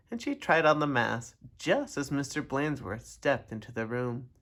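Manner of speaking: ordinary delivery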